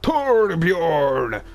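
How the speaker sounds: calling out to Torbjorn; mock shout. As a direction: bellow the name across the room. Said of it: "we're not doing that again".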